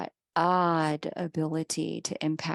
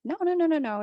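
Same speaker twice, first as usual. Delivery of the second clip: emphatic denial; 10 semitones higher, 4 dB louder, much faster than usual. Retold or imitated. Reassuring the client it's not her fault.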